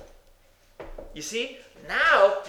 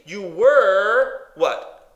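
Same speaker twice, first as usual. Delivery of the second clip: "were" stretched long and loud; stress on WERE; 3 semitones higher, 9 dB louder, much slower than usual. Shown as a demonstration. Preacher quizzing the congregation on the verse, long loud WERE. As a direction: draw out "were" forcefully, then ask. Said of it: "Can I emphasize those words to you?".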